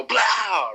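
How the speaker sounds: loud, high mock shotgun bang; imitated gun blast. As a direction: burst it out like a blast.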